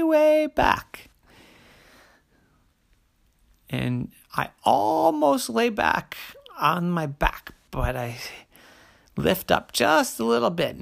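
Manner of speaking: straining bear voice. Acted. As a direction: bear leaning way back. Bear leans way back.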